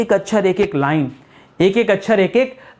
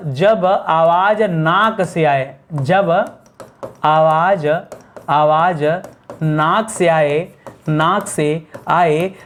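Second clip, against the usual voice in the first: very slow, lower-pitched, broken into small chunks; slow dictation; 4 semitones lower, much slower than usual. Shown as a demonstration. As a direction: dictate word by word, pausing between phrases. Dictating a rule slowly. (धीरे-धीरे, रुक-रुक कर लिखवाते हुए)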